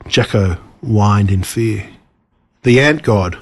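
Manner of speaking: ordinary delivery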